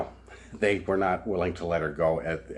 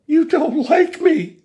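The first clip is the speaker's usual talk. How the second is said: slow, much higher mock whine; whiny accusation; 9 dB louder, slower than usual. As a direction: play her as hurt and childish. Mimicking woman's accusation.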